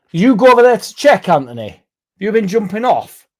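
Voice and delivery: high, loud mock outrage; loud high teasing at a viewer; excited teasing call.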